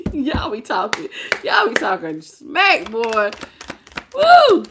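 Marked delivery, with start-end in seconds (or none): 4.1-4.7 high, loud whoop of excitement; excited whoop. Real, not acted. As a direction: let out a big joyful woo. Reacting to the cards.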